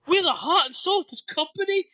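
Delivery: hammed-up stilted anime line; stilted anime character. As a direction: overdo it like a dubbed hero. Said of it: "he speaks like a stilted anime character".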